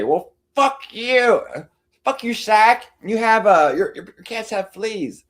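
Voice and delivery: high, loud mocking whine of Katie; mocking imitation. Streamer mimicking Katie cursing at her. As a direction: sneer it in a shrill put-on voice. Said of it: "the facetious imitation".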